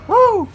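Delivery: high shrieking woo. Retold or imitated. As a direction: hit a piercing pop-star whoo. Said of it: "making [name] noise".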